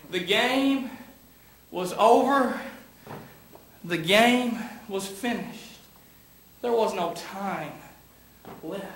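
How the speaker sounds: hushed, heavy pauses between phrases; somber preaching. Acted. Preacher mourns Kentucky's lost game to the congregation, somber and halting. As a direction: let the loss sink in, phrase by phrase.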